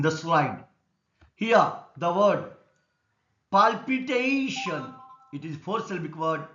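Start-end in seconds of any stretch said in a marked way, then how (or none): 3.5-4.9 palpitation slow and high, TA stretched out; stress on TA. Shown as a demonstration. Teacher explaining dictionary stress, long stressed TA in palpitation. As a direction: draw out the TA in palpitation. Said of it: "T is stressed".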